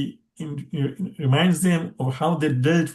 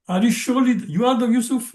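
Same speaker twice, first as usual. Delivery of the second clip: emphatic disbelief; 7 semitones higher, faster than usual. Acted. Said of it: "there's emphasis there".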